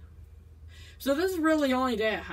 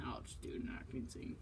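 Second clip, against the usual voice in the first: whispered aside; 11 semitones lower, 18 dB quieter than usual. Real, not acted. Muttered aside.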